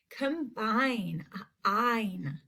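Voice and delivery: "Combine", then just its ending "ine"; long I isolated. Shown as a demonstration. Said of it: "in that second half".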